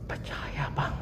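weak, breathless whispered reply; dying patient whispering back to the pastor; faint breathless whisper. Retold or imitated. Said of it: "saya bilang sama dia".

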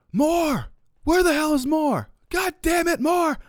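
high-pitched frantic yelling of a name; retold screaming. Coaches screaming for the snapper on the sideline, frantic high yell. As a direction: shriek for the missing player across the sideline. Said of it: "I heard just this screaming".

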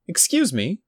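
put-on high small boy's voice; a polite little boy calling out; child's voice. A narrator's reading. Small boy at reception, high and polite. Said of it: "said a child's voice behind her".

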